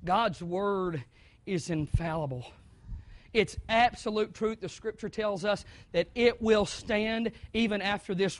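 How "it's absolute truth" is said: ordinary talk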